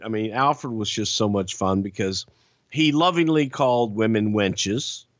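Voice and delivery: ordinary delivery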